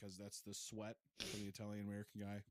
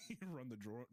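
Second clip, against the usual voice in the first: usual speaking voice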